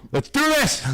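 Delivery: high, eager cry; thrilled to go; excited shout. Pretend. Eager volunteer quote.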